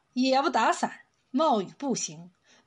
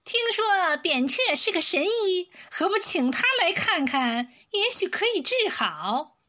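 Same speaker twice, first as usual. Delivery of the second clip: higher-pitched eunuch voice; 6 semitones higher than usual. A narrator's reading. Eunuch suggesting they send for the doctor, high-pitched voice. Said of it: "有个太监说".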